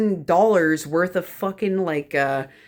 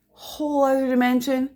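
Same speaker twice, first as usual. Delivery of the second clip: dreamy emphasis; 7 semitones higher than usual. Real, not acted. Repeated for emphasis, put-on voice.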